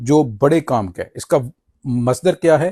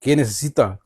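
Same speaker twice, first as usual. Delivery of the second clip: ordinary delivery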